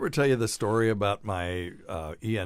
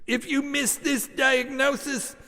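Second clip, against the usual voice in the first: much higher, slow and louder, theatrical warning; eccentric professor yelling; 6 dB louder, slower than usual. Retold or imitated. Professor yelling at his students from afar. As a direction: boom a grand threat at the class. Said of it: "Newton Fisher would yell".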